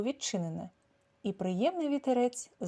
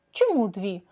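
louder, high on Три; proud retort; 5 semitones higher, 8 dB louder, slower than usual. A narrator's reading. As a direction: snap back proudly. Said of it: "з гордістю відрізала Кілька". (гордовито відрізала)

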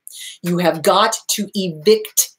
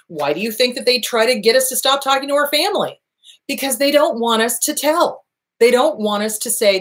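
ordinary delivery

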